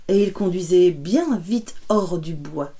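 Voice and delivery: ordinary delivery, nothing marked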